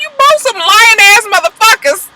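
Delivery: very high, incredulous scolding; mock outrage. Vlogger retelling her retort to the lying couple, mock outrage. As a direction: call them out in a high squawk.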